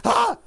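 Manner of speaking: very high, loud startled shriek; mock shriek of shock. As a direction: shriek like you got caught. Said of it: "And I'm like".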